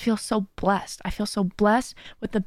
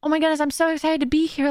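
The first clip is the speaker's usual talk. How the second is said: bright, higher greeting full of excitement; cheerful viewer; 6 semitones higher than usual. Retold or imitated. Quoting supportive viewers on stream, mock excited higher greeting. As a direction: imitate a chatty fan saying hi. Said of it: "just saying".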